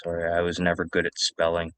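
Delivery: usual speaking voice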